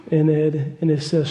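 ordinary talk, nothing marked

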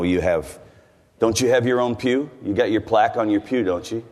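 ordinary talk

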